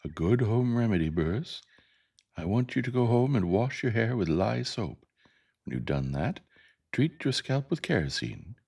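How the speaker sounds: lilting teacher giving careful instructions; Miss Caroline, tactful teacher. Acted. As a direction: politely send the pupil home.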